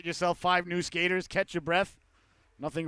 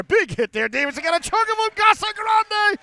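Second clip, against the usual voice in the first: shouted, much higher and louder goal call; 8 semitones higher, 7 dB louder than usual. Real, not acted. Play-by-play announcer calling the Seahawks power play goal, shouting. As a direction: explode on the score and keep riding it.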